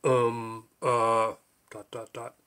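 low, hesitant filler sounds; wondering aloud. A narrator's reading. Mountain at a loss for words, wondering aloud. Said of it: "The mountain wondered aloud".

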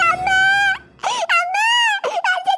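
put-on pinched child crying out to the teacher; crying complaint. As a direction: wail to the teacher that you were pinched. Pinched child calling for help.

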